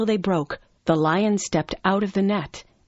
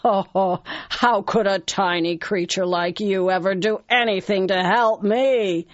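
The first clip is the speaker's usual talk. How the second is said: big amused lion voice; laugh, then scoff, drawing out the ending. Acted. Lion mocking the tiny mouse's offer, amused. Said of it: "The lion began to laugh".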